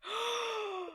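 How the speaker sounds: gasp. Real, not acted. Shocked at voice actor reveal.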